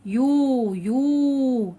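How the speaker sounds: يو held as a long "yooo" with a distinct y; يو drawn out slowly for demonstration; clear y sound. Shown as a demonstration. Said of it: "أنطقه بشكل واضح وصريح".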